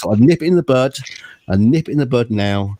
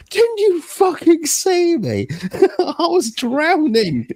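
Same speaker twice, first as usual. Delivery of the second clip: slow, very high-pitched exasperated cry; drawn-out, hammed-up indignation of a swimmer; mock outraged yell; much slower than usual. Drowning friend berating mates who waved back, mock outraged.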